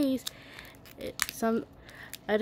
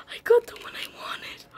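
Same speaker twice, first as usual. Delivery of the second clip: breathless, awed whisper; hushed, almost unbelieving; 12 semitones higher than usual. Real, not acted. Girl finding the toy she wanted, awed whisper. Stunned after opening toy.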